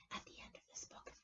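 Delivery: unvoiced fearful whisper. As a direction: hush it like a secret dread. Grover whispering about monster.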